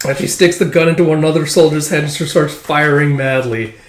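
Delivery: usual speaking voice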